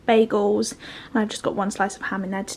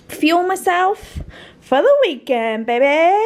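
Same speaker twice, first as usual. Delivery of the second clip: hyped-up; 5 semitones higher than usual. Real, not acted. Hyped about the weekend.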